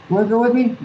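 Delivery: a lightly voiced dad, high; father's call. Dad inviting his son hunting, lightly voiced.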